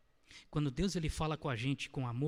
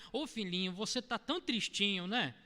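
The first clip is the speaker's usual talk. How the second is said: high, syrupy coddling voice, stretched out; hammed-up sickly sweet pity; mock sugary baby talk; 7 semitones higher than usual. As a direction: coo at them like a doting parent to a small child. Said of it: "falar com amor hoje é você chegar".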